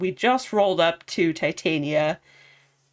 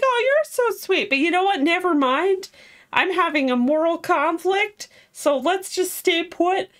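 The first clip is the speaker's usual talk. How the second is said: much higher, sing-song mock girl voice; gushing Eleanor; 11 semitones higher, 3 dB louder than usual. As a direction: gush then back off sweetly. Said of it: "she's all like".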